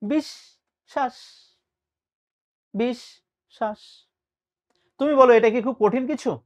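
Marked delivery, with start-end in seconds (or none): 0.0-4.1 বিশ্বাস very slow and softer, "bish ... shash"; syllable-by-syllable pronunciation. Shown as a demonstration. Teacher showing how easy it is, slow syllabled বিশ্বাস. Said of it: "বিশ্বাস শব্দের প্রমিত উচ্চারণ হচ্ছে".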